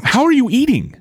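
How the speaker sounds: high-pitched baffled question; incredulous. Real, not acted. Baffled by friend's eating habits.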